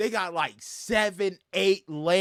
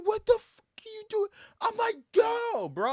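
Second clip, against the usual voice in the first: high, incredulous shout at the driver; high frustrated outburst; exasperated yell; 14 semitones higher than usual. Retold or imitated. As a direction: yell at them to go.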